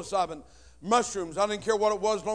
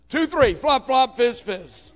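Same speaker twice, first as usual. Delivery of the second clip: loud, higher burst; gleeful countdown; 4 semitones higher, 10 dB louder, slower than usual. Retold or imitated. Teen pushes stepfather down stairs, gleeful. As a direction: shout the fall sounds with glee. Shoving him, sound effects.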